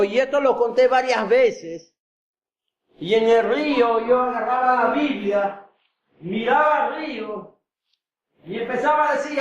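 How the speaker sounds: usual speaking voice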